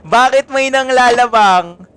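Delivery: high, loud, slow teasing question; playful loud teasing; laughing tease. Real, not acted.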